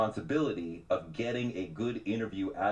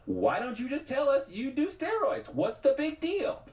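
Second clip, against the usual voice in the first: pressing higher. Retold or imitated. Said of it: "So I'm like".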